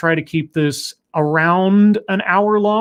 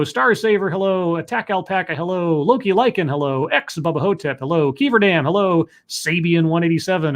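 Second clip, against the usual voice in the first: usual speaking voice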